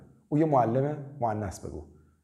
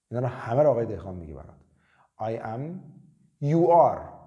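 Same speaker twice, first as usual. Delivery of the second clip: ordinary delivery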